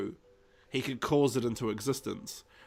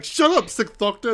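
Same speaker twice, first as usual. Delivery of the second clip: loud, high squabbling retort; mock irritated outburst; 8 semitones higher, 7 dB louder than usual. Straxus to the bragging Sixth Doctor, mock irritation. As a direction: play-act telling off a rival. Straxus snapping in retold quarrel.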